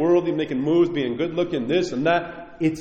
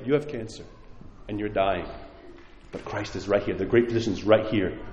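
gentle honest doctor; 6 semitones lower, 6 dB quieter than usual. Retold or imitated. True loving doctor's words.

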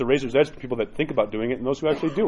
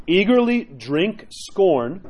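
very slow, higher, measured recitation; solemn quotation reading; 5 semitones higher, much slower than usual. Acted. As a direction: let each word of the quote land. Reads a saint's quote aloud.

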